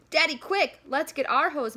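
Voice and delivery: high, loud eager shout; boy shouting to his father to grab the hose. A narrator's reading. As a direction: urge dad to hurry. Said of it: "Matthew shouts".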